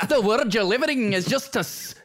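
high, over-the-top Scottish yell; terrible Scottish accent. Acted. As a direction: overdo a bad Scottish accent. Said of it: "was Scottish".

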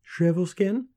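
put-on high questioning girl voice; tentative suggestion. A narrator's reading. Girl guessing the name, tentative. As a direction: venture a guess, lifting high.

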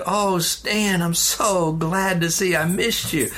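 a father happy to see his son; dad's glad greeting. Retold or imitated.

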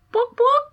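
high, loud playful boops. Retold or imitated. Mom teasing her scowling toddler with a high boop. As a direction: poke fun with a silly high boop. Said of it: "I would literally go".

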